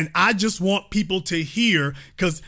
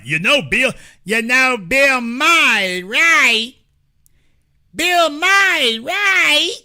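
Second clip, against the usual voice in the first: higher, louder, slow dopey caller voice climbing even higher; mocking a caller; 6 semitones higher, 5 dB louder, slower than usual. Host imitating the other side's callers in a high, loud dopey voice. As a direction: ham up the clueless caller. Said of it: "those are the folks who say things like".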